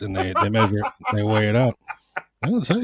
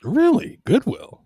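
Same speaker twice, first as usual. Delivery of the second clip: high-pitched, astonished echo; incredulous; 12 semitones higher, slower than usual. Real, not acted. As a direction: question it in high-pitched disbelief.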